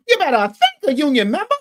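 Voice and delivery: high, loud put-on mocking voice; mocking Kamala Harris. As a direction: parody a politician pandering with a fake accent. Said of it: "Kamala Harris was changing accents".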